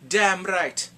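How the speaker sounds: loud, emphatic attempt at a Jamaican accent; a hint of Jamaican, punched out proudly; rough Jamaican accent. Jamaican coworker answering about the paycheck, emphatic mock Jamaican accent. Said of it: "I can't do a Jamaican".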